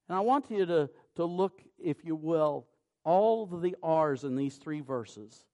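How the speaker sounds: rising preacher's fervour; preaching. Acted. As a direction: drive the point home from the pulpit. Preacher urges the congregation.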